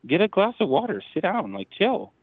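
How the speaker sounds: high-pitched, incredulous telling-off; jumpy high pitch, no extra volume, telling someone to calm down; retold exasperation. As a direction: act out exasperation at someone overreacting. Retold exasperation at panicking wife.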